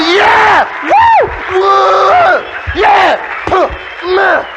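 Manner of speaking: loud high victory whoops; team cheering. Retold or imitated. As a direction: whoop it up like the team after the tackle. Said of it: "And we're all going".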